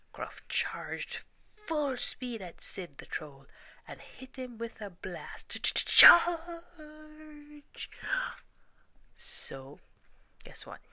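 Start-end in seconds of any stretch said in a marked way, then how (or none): 5.6-7.9 high, loud charging shout; battle cry. Acted. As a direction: yell it as you charge. Goat charges troll.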